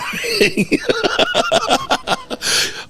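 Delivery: shrieking burst of laughter. Real, not acted. Huge laugh after the text.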